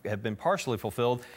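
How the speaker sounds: usual speaking voice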